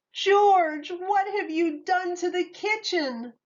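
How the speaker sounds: a mother shocked at the mess in her kitchen; mother catching George with the ruined kitchen, upset. A narrator's reading. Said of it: "didn't look very happy".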